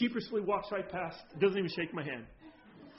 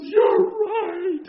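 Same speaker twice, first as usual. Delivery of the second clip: much higher, louder, crying out the words; weeping admission; 13 semitones higher, 14 dB louder than usual. Retold or imitated. Church lady admitting fault to the pastor, weeping loudly. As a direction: break down crying as you agree. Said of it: "and starts weeping".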